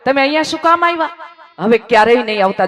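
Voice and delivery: high, fast, loud rebuke of his father; angry scolding. Retold or imitated. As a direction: shoo the father away in shame. Ashamed son scolds his father.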